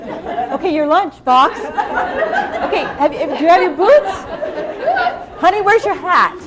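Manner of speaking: 허둥지둥 다급하게 외치는 엄마 목소리; frantic rushing mom. Retold or imitated. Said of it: "I was running, like, all morning".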